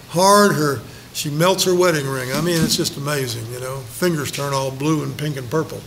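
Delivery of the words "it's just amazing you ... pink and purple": usual speaking voice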